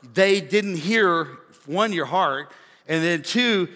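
slow emphatic. Shown as a demonstration. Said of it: "stretched for emphasis".

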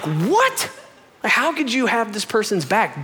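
high-pitched incredulous protest; mock indignation. Reacting to friend's criticism, mock indignation. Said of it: "I was so mad".

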